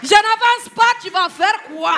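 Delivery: put-on high, loud, challenging Ivorian accent; full-blown mock-indignant Ivorian dare; Ivorian mama, escalated. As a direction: shout defiance like an Ivorian mother. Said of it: "il y a une maman ivoirienne".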